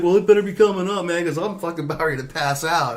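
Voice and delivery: exhausted friend, quick and high; tired friend. Retold or imitated. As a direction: whine that he's about to pass out.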